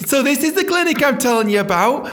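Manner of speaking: high, loud chirpy manager; brightly oversell the clinic; mock awkward manager. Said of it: "she'd go".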